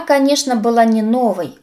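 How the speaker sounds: usual speaking voice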